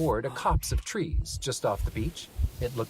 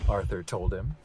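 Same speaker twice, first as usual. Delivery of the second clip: ordinary delivery, nothing marked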